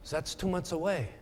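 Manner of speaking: soft, lightly faked French accent. Seatmate doubts the early card writing, soft mock French accent. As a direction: object quietly with a touch of French. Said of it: "in kind of this French accent".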